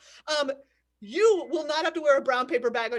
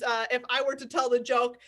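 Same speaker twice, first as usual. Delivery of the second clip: ordinary talk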